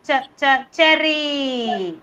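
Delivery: short repeated "ch, ch", then a stretched "cherry"; first sound isolated. Shown as a demonstration. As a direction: tap out "ch" twice and draw out "cherry". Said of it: "Can you hear the ch sound?".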